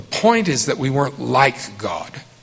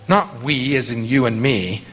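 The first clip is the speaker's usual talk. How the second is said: ordinary talk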